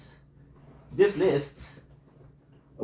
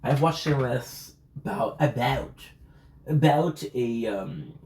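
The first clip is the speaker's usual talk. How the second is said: ordinary talk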